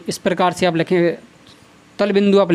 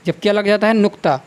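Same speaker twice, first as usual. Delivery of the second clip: ordinary talk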